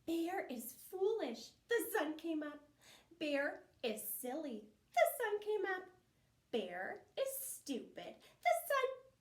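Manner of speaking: high, loud taunting singsong; mock him like a cheeky little squirrel; loud squeaky taunt; teasing. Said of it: "began to tease Bear".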